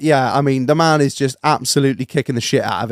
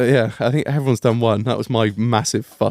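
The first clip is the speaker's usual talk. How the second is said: ordinary talk, nothing marked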